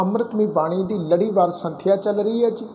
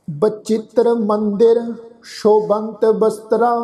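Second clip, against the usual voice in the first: drawn-out, syllable by syllable scripture reading; slow recitation; slower than usual. Shown as a demonstration. Teacher reciting the hymn slowly for the congregation. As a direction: lay each syllable down slowly and reverently. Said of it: "Bachittar ਚੱਚੇ ਨੂੰ ਸਿਹਾਰੀ".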